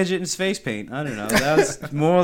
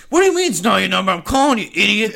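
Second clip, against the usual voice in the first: higher, louder, fast berating; mock scammer scold; 5 semitones higher, 4 dB louder, faster than usual. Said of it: "They're like".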